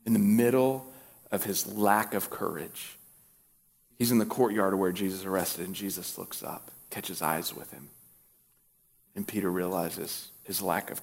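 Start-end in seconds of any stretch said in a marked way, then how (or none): none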